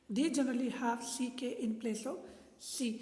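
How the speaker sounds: ordinary delivery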